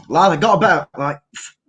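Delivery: put-on Scottish lad's accent; improvised Scottish accent. Shown as a demonstration. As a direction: answer in a hammy Scottish brogue.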